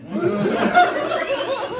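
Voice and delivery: high burst of laughter; laughing. Real, not acted. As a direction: crack up loudly. Laughing at own story.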